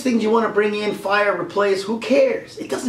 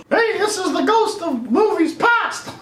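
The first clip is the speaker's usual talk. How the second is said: much higher, slow put-on ghost voice; ghost of movies past; 12 semitones higher, slower than usual. Ghost introducing itself to Mickey, high and slow. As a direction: announce yourself like a hammed-up spirit.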